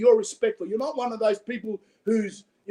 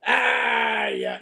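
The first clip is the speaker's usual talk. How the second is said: drawn-out piratical growl; British sailor talk. Acted. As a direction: imitate an old sailor's "arr". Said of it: "And they were taught".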